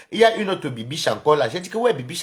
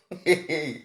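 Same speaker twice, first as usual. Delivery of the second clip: short chuckle after a taunt; teasing laugh; 4 dB quieter than usual. Real, not acted. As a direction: chuckle to yourself. Laughs after singing the threat.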